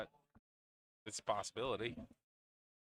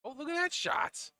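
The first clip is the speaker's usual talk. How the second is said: very high, loud burst of amazement; excited exclamation; 6 dB louder than usual. Real, not acted. Commentator reacting live to a great shot, excited. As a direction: exclaim in real awe, pitch leaping up.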